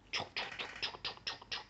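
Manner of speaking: soft voiceless chuc-chuc steps; hushed, rhythmic step noises; whispered climbing footsteps. Acted.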